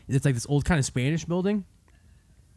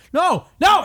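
alarmed refusal; 11 semitones higher, 5 dB louder than usual. Retold or imitated. Mimics strangers refusing the tickets.

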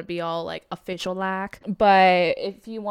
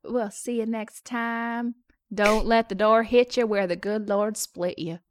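mock Southern; 4 semitones higher than usual. Southern sign-off to listeners.

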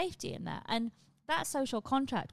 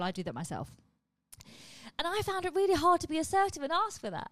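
ordinary delivery

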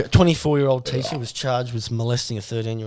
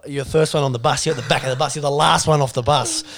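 normal talking voice

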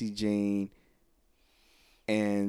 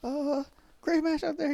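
much higher, awkward compliment; nervous girl voice. Retold or imitated. Girl complimenting a wrestler after his match, much higher. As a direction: gush it out shyly. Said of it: "She's like".

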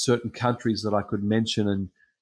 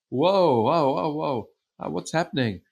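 high, swooping reaction of surprise; startled alarm; 5 semitones higher than usual. Retold or imitated. Preacher reacting to a girl screaming mid-sermon, acted startled alarm. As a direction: act out being thrown by an interruption.

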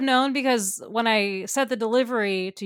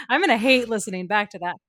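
normal talking voice

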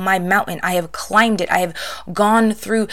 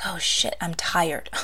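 weary hushed sigh; 2 semitones lower, 8 dB quieter, slower than usual. Retold or imitated. Quietly admitting to yourself you're tired. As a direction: drop to a tired, soft whisper. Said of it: "And you're like".

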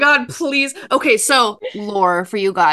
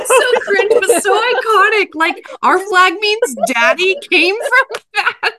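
high squealing laugh through the words; 12 semitones higher, 4 dB louder than usual. Real, not acted. Fan squealing with laughter over the cringe scene.

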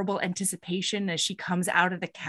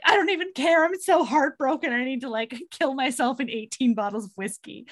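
higher, mock despairing; hammed-up heartbreak; 5 semitones higher than usual. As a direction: wail it like a melodramatic jilted lover. Heartbroken man voiced.

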